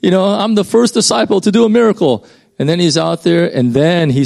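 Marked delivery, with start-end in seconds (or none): 0.0-2.2 high, thrilled mock wonder as Peter; giddy disciple, pitched way up; amazed Peter. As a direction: squeal with delight at walking on water. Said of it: "He's like".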